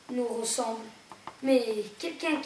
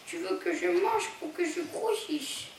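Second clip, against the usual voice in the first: put-on small raised voice of a sad young gull; 6 semitones higher than usual. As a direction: voice the hurt little seagull, higher. Young gull asks the cat.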